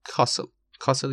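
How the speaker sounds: castle with British back A, "KAH-sul"; British pronunciation. Shown as a demonstration. Teacher repeating the variant for learners, British pronunciation.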